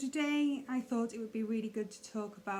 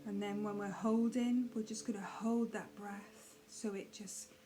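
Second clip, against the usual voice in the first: soft, unhurried meditation guide with long pauses; hushed, calm mindfulness voice; guided meditation; 5 dB quieter than usual. Acted. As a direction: gently settle the listener into breathing, leaving space between phrases.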